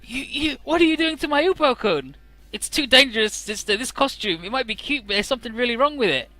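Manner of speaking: upset fan. Retold or imitated. Akane and sister arguing.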